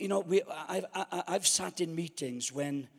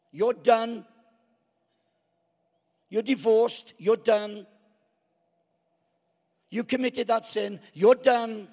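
loud, high accusing verdict; harsh condemning voice; 5 semitones higher, 8 dB louder than usual. Acted. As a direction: slam the door on them. Voicing religion condemning people.

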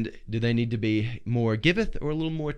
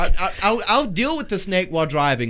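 raised, high-pitched protest; mock insistence; 5 semitones higher, 5 dB louder than usual. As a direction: play the unstoppable driver, loud and high. Acting out unbothered Australian driver.

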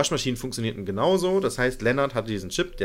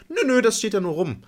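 breezy high reply; 9 semitones higher, 4 dB louder than usual. Retold or imitated. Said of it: "Nee, da gibt's Waschpulver".

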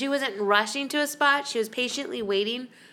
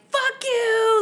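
high, loud furious shout; retold furious yell; 13 semitones higher, 6 dB louder than usual. Mom screaming at the old couple who stole her spot. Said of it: "I'd never seen my mom so mad".

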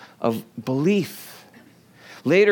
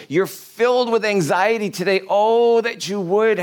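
raised, high-pitched pleading, swelling on the Oh; impassioned plea; 7 semitones higher than usual. Acted. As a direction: plead earnestly in Jesus' words. Preacher voices Jesus pleading with him.

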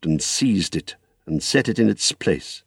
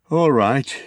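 higher, reluctant agreement; with an effort; 5 semitones higher than usual. Acted. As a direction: give in with some effort. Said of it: "he said with an effort".

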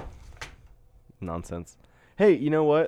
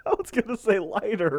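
higher, laughing disbelief; 13 semitones higher, 4 dB louder than usual. Real, not acted. Teasing a friend about the bath answer, laughing. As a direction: ask it while still cracking up.

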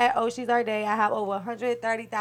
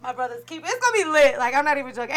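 ordinary delivery

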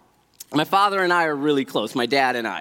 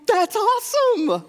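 excited; 14 semitones higher than usual. Retold or imitated. Speaker's own excited reply.

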